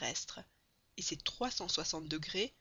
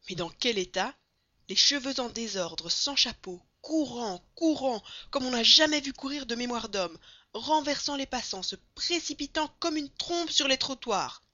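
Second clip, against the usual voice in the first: raised, forceful narration building in urgency; excited story narration; 6 semitones higher, 9 dB louder than usual. A narrator's reading. Narrator on Passepartout's wild run through the streets, urgent. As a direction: narrate the frantic chase loud and high.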